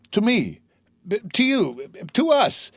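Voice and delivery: high, tumbling with joy; joyful, tripping over words; excited. A narrator's reading. Edmund's joyful stumbling.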